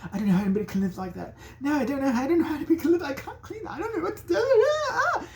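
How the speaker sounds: high, laughing, panicky British woman; high mock-hysterical British woman who can't clean; freaking out woman. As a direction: babble in hysterical disgust, rising to a shriek.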